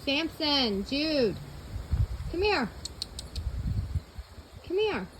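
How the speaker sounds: calling the dogs. Real, not acted. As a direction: call the dogs in.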